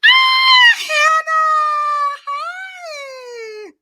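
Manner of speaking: very high, drawn-out squealed hello; excited squeal. Real, not acted. Host greeting a viewer who just arrived, excited squeal. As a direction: squeal the name and hold the notes.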